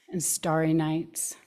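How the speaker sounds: ordinary talk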